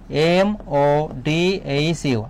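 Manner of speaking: slow letter-by-letter spelling; teacher spelling a word letter by letter, slowly. Shown as a demonstration. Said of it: "বাংলিশে লিখব".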